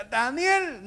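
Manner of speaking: loud, high-pitched call; king calling out anxiously; anguished shout. Acted. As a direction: cry the name out in anguish. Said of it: "gritó con angustia".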